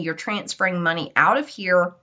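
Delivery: ordinary delivery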